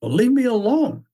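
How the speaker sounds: high, curt brush-off; Sisyphus voice. Acted. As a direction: shoo him away from up high. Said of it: "He comes in and goes".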